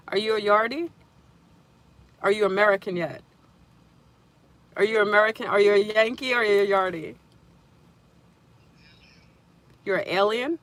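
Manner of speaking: loud, playful phone banter with a friend; loud teasing. Real, not acted. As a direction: tease the caller loudly about being a yardie.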